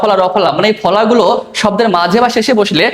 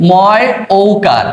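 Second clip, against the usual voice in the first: very slow, spelled out letter by letter; slow spelling demo for the class; spelling aloud; much slower than usual. Shown as a demonstration. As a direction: drag out ময় ঔকার as you spell it. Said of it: "মৌ".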